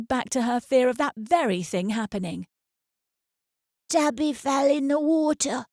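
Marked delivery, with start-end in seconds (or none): 3.9-5.6 put-on small boy's voice, higher and bright with news; a young boy blurting the news; child voice. A narrator's reading. Little son tells his mother, boy voice.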